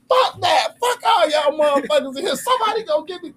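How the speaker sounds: high, shouted retold rant; irate. Retelling going off at the staffing office, high shout. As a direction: yell it, reliving the rage. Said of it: "when I say I was irate".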